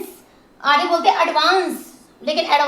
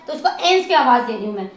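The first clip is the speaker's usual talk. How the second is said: ordinary talk, nothing marked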